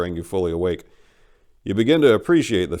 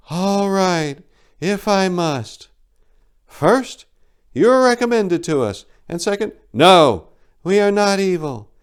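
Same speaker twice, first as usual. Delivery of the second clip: high, louder bird-like voice, impatient; flat eagle voice; 12 semitones higher, 8 dB louder, slower than usual. Acted. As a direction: answer curtly as the giant eagle. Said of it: "she said flatly".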